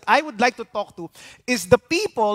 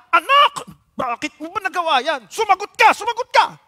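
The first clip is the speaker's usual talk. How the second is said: high-pitched mock parental scolding; 10 semitones higher than usual. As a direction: imitate a parent demanding an answer, voice shooting up. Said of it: "pagagalitan yung anak".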